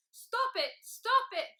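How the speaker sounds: loud, high acted pleading shout; crying out. A narrator's reading. Tickled dragon begs Mister Tickle to stop, high loud cries. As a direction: cry out helplessly to make it end. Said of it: "he cried".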